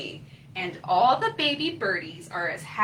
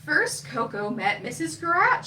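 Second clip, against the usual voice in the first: plain reading voice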